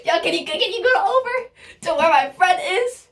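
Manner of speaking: put-on a giggly teen boy delivering a message; imitating the boy. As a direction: play the kid asking, giggling. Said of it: "And he's like".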